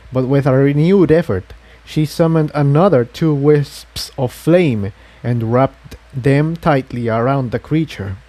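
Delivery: ordinary delivery, nothing marked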